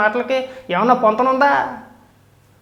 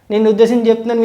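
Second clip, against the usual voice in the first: ordinary talk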